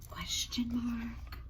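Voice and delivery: a gasp, then a breathy whisper. Acted.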